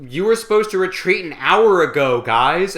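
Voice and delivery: loud call across the battlefield; mock shout. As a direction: shout it up the hill at the gunners. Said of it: "some rando shows up and is just like".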